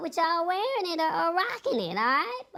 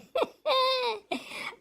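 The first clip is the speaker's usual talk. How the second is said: laughing; 8 semitones higher, 4 dB quieter than usual. Real, not acted. Laughing at viewers' comments.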